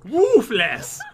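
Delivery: mock-imitated "Wolfless", punched on a woofing first syllable; imitating his pronunciation. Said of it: "That's how he says it".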